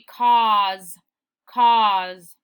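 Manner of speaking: cause as "kawz", long aw vowel, loud; corrected reading of cause. Shown as a demonstration. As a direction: draw out the aw in cause. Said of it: "But in this case".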